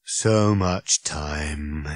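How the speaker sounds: low, very slow weary groan. Acted. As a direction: drag it out like a worn-out robot. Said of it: "it groaned".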